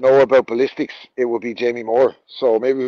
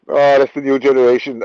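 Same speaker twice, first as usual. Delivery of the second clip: ordinary talk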